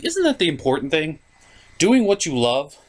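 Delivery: ordinary talk, nothing marked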